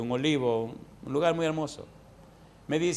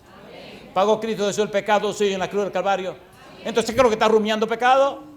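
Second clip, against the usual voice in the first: raised, pressing pulpit questions; forceful preaching; 5 semitones higher, 4 dB louder than usual. Real, not acted. Preacher challenging a believer about forgiven sin, raised and insistent. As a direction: drive each question home to the congregation.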